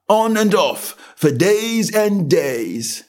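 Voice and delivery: high and slow, drawn out; slow footman. A narrator's reading. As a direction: settle in without hurry. Footman repeats.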